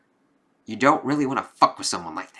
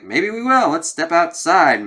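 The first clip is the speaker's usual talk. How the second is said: higher, louder, slow "let's step outside"; mock macho challenge, drawn out; challenging taunt; 7 semitones higher, 5 dB louder, slower than usual. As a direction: egg him on into a fight. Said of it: "And he's like".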